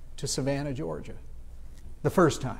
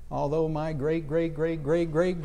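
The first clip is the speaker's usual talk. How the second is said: usual speaking voice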